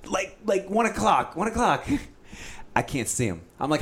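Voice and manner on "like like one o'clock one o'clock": 1 mock excited. Mimicking the guide's directions.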